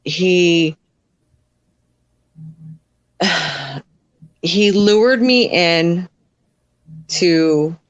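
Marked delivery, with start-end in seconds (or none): 3.2-3.8 long, audible exhale; weary out-breath; heavy sigh. Real, not acted. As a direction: sigh out before going on. Pauses before painful part of story.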